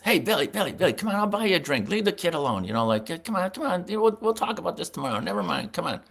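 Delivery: high-pitched imitated coaxing; placating intervention. Traveling secretary luring manager away from the kid, placating.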